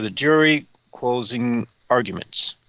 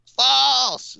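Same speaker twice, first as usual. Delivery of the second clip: high, stretched-out shout; mock heckler yell; 4 dB louder than usual. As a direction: holler it like a heckler in the crowd. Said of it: "someone in the crowd yelled".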